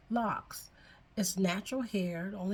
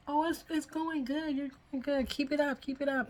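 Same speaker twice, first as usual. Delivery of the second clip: higher-pitched cheering-on voice; imitated encouragement; 7 semitones higher than usual. Sister-in-law urging her to keep it up, encouraging.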